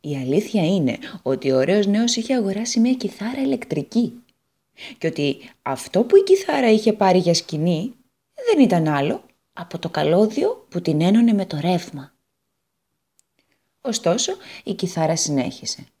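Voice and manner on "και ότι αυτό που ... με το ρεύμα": ordinary delivery, nothing marked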